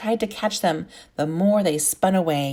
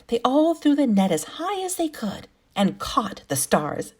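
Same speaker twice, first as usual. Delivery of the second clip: ordinary delivery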